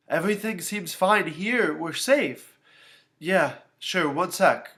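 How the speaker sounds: lightly voiced sitter reporting all is safe; anxious babysitter. A narrator's reading. Babysitter on the phone.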